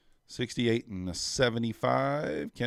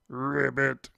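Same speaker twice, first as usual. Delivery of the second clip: frog croak; 4 semitones higher than usual. Pretend.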